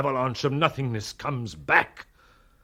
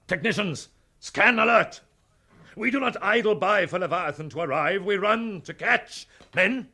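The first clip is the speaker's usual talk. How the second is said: commanding orders; 6 semitones higher than usual. Acted. Captain sending squads to their stations, shouting orders. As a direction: bark the orders to the whole deck.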